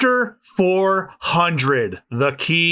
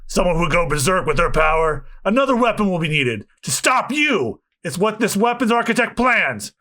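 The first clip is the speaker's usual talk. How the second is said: ordinary delivery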